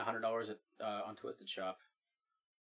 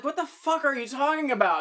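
baffled outburst; 12 semitones higher, 5 dB louder than usual. Retold or imitated. Retold own confusion at a song.

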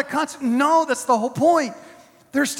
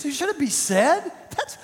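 normal talking voice

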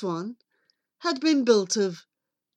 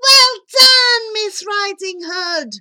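high, loud jubilant yell; triumphant pig shouting from the window; 10 semitones higher, 9 dB louder than usual. Acted. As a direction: cheer at the top of your piggy voice. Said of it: "stood and yelled".

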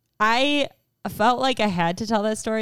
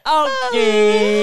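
high loud drawn-out okay; excited; 14 semitones higher, 8 dB louder than usual. Real, not acted. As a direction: sing out the okay.

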